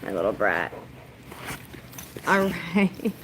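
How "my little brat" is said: low teasing. Real, not acted. Teasing her dog.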